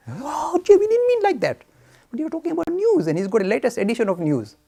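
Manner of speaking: high, loud, startled protest; flustered minister. Retold or imitated. Minister protests to Jay in a high, flustered voice. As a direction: backpedal in alarm.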